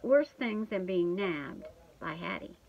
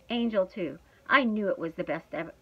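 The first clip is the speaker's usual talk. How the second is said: usual speaking voice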